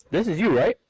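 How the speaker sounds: imitated scammer with an Indian accent. Scam caller checking personal information, imitated Indian accent. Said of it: "switch off an Indian accent".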